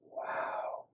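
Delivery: soft, breath-only whisper; hushed and hoarse; awed whisper. A narrator's reading. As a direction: whisper it in wonder. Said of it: "he whispered".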